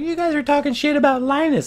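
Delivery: high whiny mock commenter; squeaky voice mocking an online troll; mocking viewer voice.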